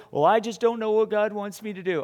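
a hint of a whiny doubter; doubting believer. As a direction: play the unsure believer, lightly mocking.

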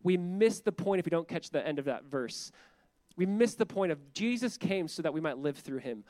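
usual speaking voice

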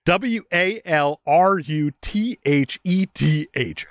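W A L R U T H E T H spelled slowly letter by letter; spelling it out. Shown as a demonstration. Host reading the lisped spelling aloud, spelling slowly. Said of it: "the way it's written".

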